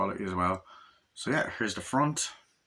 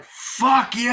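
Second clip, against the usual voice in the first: high, loud drawn-out curse; acted outraged shout; furious parting yell; 12 semitones higher, 8 dB louder than usual. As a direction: yell it after a departing train. Acting out her furious parting curse.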